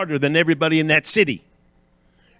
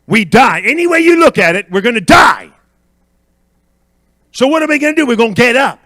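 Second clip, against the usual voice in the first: high, loud pulpit shout; roused preacher at full voice; full-force preaching; 9 semitones higher, 10 dB louder than usual. Real, not acted. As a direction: shout the conclusion to the whole church.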